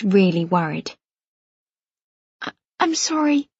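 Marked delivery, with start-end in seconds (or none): 2.4-3.5 halting, stammered apology, softer; stammering. A narrator's reading. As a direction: stumble over the I in I'm sorry. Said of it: "I stammer".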